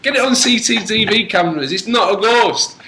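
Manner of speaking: high-pitched, insistent argument; exasperated protest. Retold or imitated. Exasperated argument with hotel owner.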